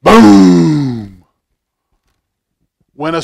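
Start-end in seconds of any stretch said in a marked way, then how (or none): 0.0-1.2 big booming shout of thunder; loud thunder boom. Acted. Reading the storm page to children, loud thunder boom. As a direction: make the room shake with it.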